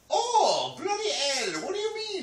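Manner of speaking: loud, high, hammed-up English accent. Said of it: "do your English accent".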